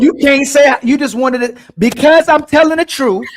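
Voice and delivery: loud, high, heated comeback; heated argument. Real, not acted. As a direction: fire back with passion. Arguing back heatedly.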